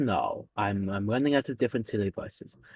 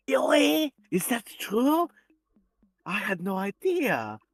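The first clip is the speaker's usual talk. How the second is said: higher, mock surprised German-accented villain; Doofenshmirtz voice; 9 semitones higher than usual. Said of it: "Why do you sound like Doofenshmirtz?".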